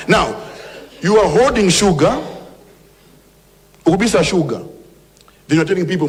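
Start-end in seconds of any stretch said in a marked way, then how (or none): none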